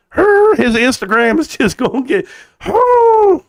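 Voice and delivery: rising into a high squeal; excited squeal. Real, not acted. Streamer hyping a twerk video, high excited squeal. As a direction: build up then squeal the oh.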